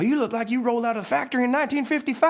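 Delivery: fast, very high astonished character; freaked-out Mater. Acted. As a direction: blurt it out in high-pitched shock. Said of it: "he kinda freaks out".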